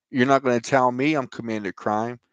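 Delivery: usual speaking voice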